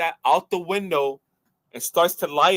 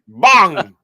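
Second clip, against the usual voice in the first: loud, punchy gunshot "bang"; big sound-effect bang; imitated bang; 10 dB louder than usual. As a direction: blurt it out like a sudden blast. Acting out the tank being shot.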